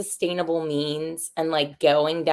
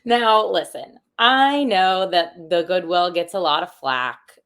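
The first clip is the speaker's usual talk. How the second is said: ad read; 3 semitones higher, 6 dB louder than usual. Pretend. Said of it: "gonna be a commercial for the Goodwill".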